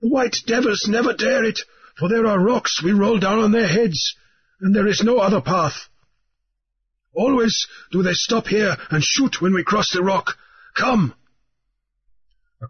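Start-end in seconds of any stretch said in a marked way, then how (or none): 0.0-11.1 high, earnest explaining; high, earnest guidance; islander guide. A narrator's reading. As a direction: guide him across the rock. Mauriri explains the path.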